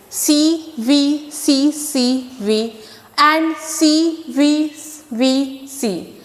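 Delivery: slow, letter by letter pattern; slow spelling. Shown as a demonstration. Teacher slowly spells the pattern letters to kids. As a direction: spell each letter out for the class. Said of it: "spells out the pattern slowly".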